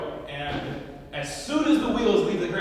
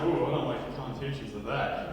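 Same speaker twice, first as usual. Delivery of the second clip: lower, softer mock disapproval; uneasy onlookers; 6 semitones lower, 5 dB quieter than usual. Passengers look around after the mom's remark, uneasy. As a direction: back away verbally, quietly uneasy. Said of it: "Everyone kinda looks around like".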